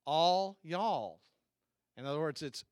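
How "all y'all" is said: loud Southern US drawn "All y'all"; John Paul Jackson. Retold or imitated. Quoting a friend from the South, loud Southern accent. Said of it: "I'll say it the way that a friend of mine, John Paul Jackson, would say".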